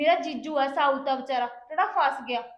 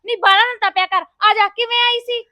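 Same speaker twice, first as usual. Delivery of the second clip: loud, higher scolding; 5 semitones higher, 12 dB louder than usual. Acted. Younger sister loudly telling her angry sister to calm down. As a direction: tell her off at full volume.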